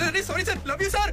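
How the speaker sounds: very high, hurried placating voice; panicked apology. Acted. Boy placating an annoyed sir, high-pitched apology. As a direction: squeal an apology to smooth things over.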